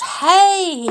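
high, loud yell. Acted. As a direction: yell out in protest. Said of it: "he yelled".

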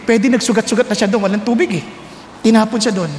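ordinary talk, nothing marked

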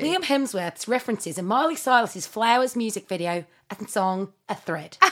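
put-on Australian accent for reading aloud. Shown as a demonstration. Reading a fan thread as [name], in an Australian accent. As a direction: read it in a mock Australian accent. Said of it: "Can you do it in the voices of [name] and [name]".

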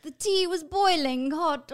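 slow, high mock British; imitated British accent. Woman reminding guest of his earlier attempt, mock British accent. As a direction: mimic his bad British accent, drawn out. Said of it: "You were doing it better before".

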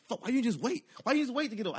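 high, loud scolding from a passenger; mad complaint. Retold or imitated. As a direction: imitate friends fussing at the driver. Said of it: "they getting mad at me".

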